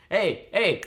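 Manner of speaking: caricatured red-state bigot, loud start fading down. Retold or imitated. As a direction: lampoon a bigoted local lecturing the room.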